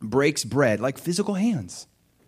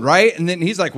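ordinary talk, nothing marked

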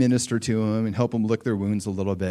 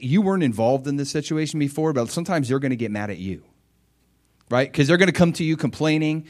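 usual speaking voice

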